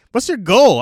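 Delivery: high, loud demand; baffled hero. Acted. Hero demanding the villain's goal, loud and exasperated.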